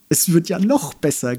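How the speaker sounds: bawled. Acted. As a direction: bawl to the laughing crowd. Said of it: "gröhlte ein anderer". (gegröhlt, ausgelassen)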